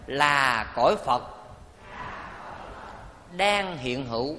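slow, measured phrases with long pauses; deliberate call-and-repeat recitation; leading group recitation. Real, not acted. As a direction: lead the congregation phrase by phrase. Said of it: "Mời quý vị cùng nói với thầy".

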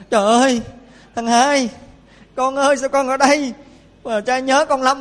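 loud, high crying-out to his son; wailing father. Retold or imitated. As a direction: wail over the son found again. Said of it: "ông khóc lóc ỉ ôi". (giọng cha khóc lóc ỉ ôi, to và cao)

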